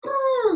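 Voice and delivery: high loud mock trumpeting; hammed-up elephant call. Shown as a demonstration. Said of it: "And then we'll see an elephant".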